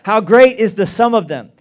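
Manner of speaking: verse called out loud and strong; proclaimed loud. Shown as a demonstration. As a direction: boom the opening line of the psalm. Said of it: "verse read out loud".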